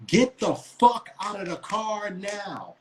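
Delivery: high, slow, drawn-out cop order; police command. Retold or imitated. Imitating the cop ordering the driver out, slow and high. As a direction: order him out like an officer.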